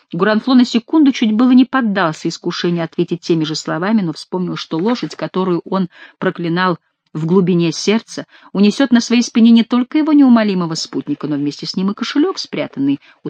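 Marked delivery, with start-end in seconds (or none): none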